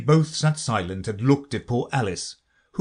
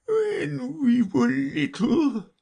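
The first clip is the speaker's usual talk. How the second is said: very slow, high, tearful reminiscence; Mock Turtle; much slower than usual. A narrator's reading. Mock Turtle resumes his story, calmer but tearful. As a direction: reminisce slowly, still a little sobbing. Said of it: "more calmly".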